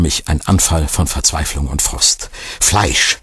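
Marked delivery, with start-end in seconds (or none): none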